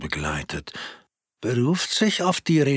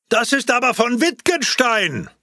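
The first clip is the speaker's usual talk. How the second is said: high, louder exclamation of discovery; sudden shout; 14 semitones higher, 4 dB louder than usual. Acted. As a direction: call it out as if it leaps from nowhere. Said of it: "dass jemand rief". (ein plötzlicher, hoher Ausruf aus dem Nichts)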